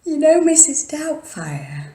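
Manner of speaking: high, bright excited rush. Real, not acted. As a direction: blurt it out thrilled. Excited recollection, laughing.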